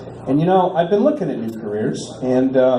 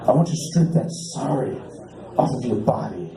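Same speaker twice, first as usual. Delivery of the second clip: low, soft seductive purr; softer, lower lewd caller voice; sultry caller; 5 semitones lower, 5 dB quieter than usual. Retold or imitated. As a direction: breathe it like a creepy come-on. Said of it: "And he goes".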